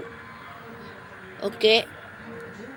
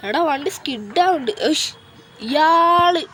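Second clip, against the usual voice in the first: annoyed outburst; 5 semitones higher, 3 dB louder, slower than usual. Real, not acted. Car skids, another driver cuts in.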